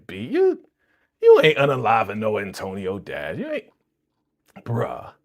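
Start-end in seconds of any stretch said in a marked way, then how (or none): none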